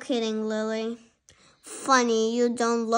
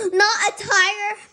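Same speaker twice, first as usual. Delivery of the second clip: shocked gasp; 7 semitones higher, 6 dB louder than usual. Acted. Lily hears she may not be a tiger, shocked. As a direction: gasp then higher, louder not a tiger.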